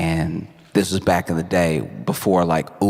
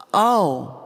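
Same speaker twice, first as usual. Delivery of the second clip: caught-out oh; 12 semitones higher than usual. Acted. Daughter learns husband heard.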